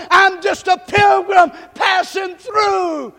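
loud, climbing sermon cadence, hammering each line; preaching at full force. Acted. Pastor rousing the church, preaching at full force. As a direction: drive it home to the congregation.

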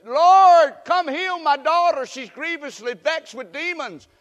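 high, loud cry of a desperate mother; pleading mother. Acted. Syrophoenician woman begging Jesus to heal her daughter, loud cry.

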